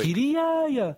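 high-pitched exasperated cry; exasperated urging. Real, not acted. Host demanding the minister get involved, exasperated. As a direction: urge him on, voice leaping up high.

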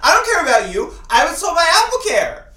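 high, loud entitled mock rant; hammed-up Karen imitation; Apple Care lady. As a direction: play the entitled customer, shrill and high. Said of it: "That's the sort of thing".

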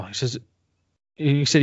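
ordinary delivery

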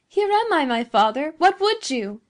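put-on high, loud voice of a young woman; rich youthful cry. A narrator's reading. Daughter answering her father from a window, rich youthful cry. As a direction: call back brightly in a rich, youthful voice. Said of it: "cried a rich and youthful voice".

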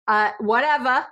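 a light New York accent on whatever. Shown as a demonstration. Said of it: "my New York is gonna come out now".

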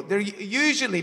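ordinary talk, nothing marked

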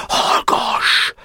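whispered command; hushing whisper. Acted. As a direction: silence him and calm him. Boka hushing the boys.

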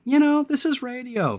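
high, soft put-on radio voice; radio talk. Shown as a demonstration. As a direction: lift it lightly into on-air talk. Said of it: "radio talk in there".

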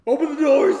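high, very loud demand; panicked shout. Retold or imitated. Panicked rider to the operators, high desperate shout. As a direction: yell to be let out at once.

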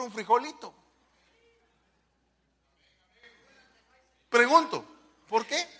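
preaching challenge. Real, not acted. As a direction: push the congregation, climbing in pitch. Preaching questions rising higher. (reto de predicador, cada vez más agudo)

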